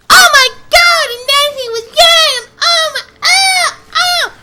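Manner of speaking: put-on very high, loud squeaky teen voice; mocking squeaky kid. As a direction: ham up a hyper fourteen-year-old. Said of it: "he's like a fourteen-year-old boy".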